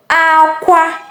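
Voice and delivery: "Aqua" high on both syllables; high-high tones on "Aqua"; cry tone. Shown as a demonstration. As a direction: hold "Aqua" high and level to the end. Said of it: "you have to listen attentively".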